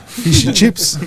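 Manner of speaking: high-pitched Aussie vowels in "fish and chips"; mock Aussie accent. Host echoing an Aussie saying fish and chips, mock accent. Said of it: "like an Aussie trying to say".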